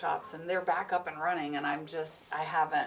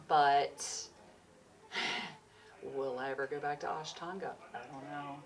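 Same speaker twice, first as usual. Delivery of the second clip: ordinary talk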